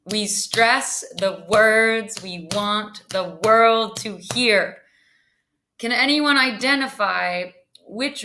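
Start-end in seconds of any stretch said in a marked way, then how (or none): 0.1-4.8 slow, measured beat on "stress", "words", "want", "world", "hear"; stressed iambic motto. Shown as a demonstration. Teacher repeating the motto for students, "stress", "words", "want", "world", "hear" stressed. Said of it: "say that one more time".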